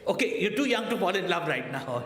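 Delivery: high, loud, laughing father; exasperated father. Acted. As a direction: hurriedly talk the kid down, amused. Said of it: "And he's saying".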